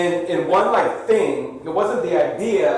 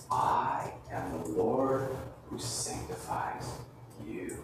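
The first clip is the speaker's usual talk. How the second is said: slow, much softer, fading to a whisper; reverent hush; 10 semitones lower, 14 dB quieter than usual. Acted. Preacher quoting God to the congregation, hushed reverent. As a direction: speak God's words in awe.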